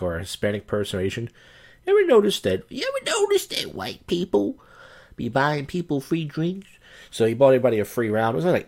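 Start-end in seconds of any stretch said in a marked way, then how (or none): 1.9-2.6 high, loud call to the audience; much higher, louder rhetorical question. Acted. Asking the audience, then restated. 2.7-6.7 very high, slow comic voice; squeaky, slow hammed-up caricature voice; put-on voice. Restated joke in put-on voice.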